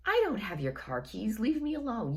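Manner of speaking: fed up, begging to be left alone; exasperated. Retold or imitated. Drunk guy asks about keys again.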